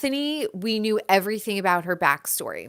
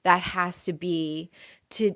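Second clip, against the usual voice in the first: ordinary talk, nothing marked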